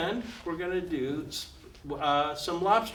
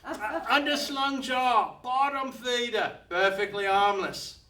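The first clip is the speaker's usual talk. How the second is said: much higher, offhand put-on voice; crocodile hunter voice; 9 semitones higher, 3 dB louder, slower than usual. Crocodile hunter casually tells Lou to release the shark, lightly voiced. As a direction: toss it off like it's nothing. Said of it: "He goes".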